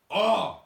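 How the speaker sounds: loud, high cry of exasperation; exasperated outburst. Real, not acted. Venting to co-host about the flight, loud exasperated cry. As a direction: burst out with a fed-up "oh".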